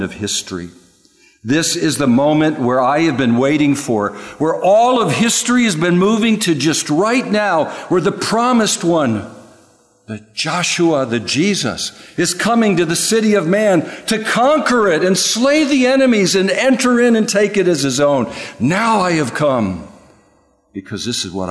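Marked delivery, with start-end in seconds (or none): 1.4-18.3 high and loud, building to a climax; fervent pulpit crescendo; full-force preaching. Acted. 18.6-19.9 "Now" punched higher and louder, line slow; weighty proclamation; emphatic now. Acted. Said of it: "The now in the Hebrew is emphatic".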